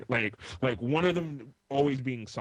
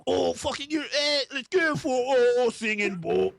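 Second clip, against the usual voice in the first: higher, louder hammed-up Cockney yell; mock Cockney accent; 11 semitones higher, 5 dB louder than usual. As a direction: bellow it like a rowdy Cockney fan. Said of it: "It's a beautiful Cockney accent".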